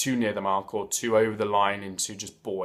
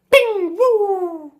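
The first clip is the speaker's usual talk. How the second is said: high, loud "bing", then a long whistling "woo"; cartoon ping and falsetto whoosh; imitated flying nail; 8 dB louder than usual. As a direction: sound out a nail pinging and sailing away.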